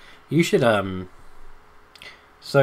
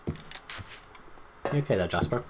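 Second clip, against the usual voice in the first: slow, soft whisper to the cat; whispered check-in; 6 dB quieter, much slower than usual. Real, not acted. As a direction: whisper gently so not to startle.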